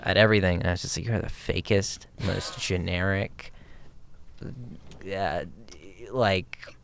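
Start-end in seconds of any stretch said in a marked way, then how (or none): 1.1-6.8 sneering. Retold or imitated. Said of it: "I just sneered at her".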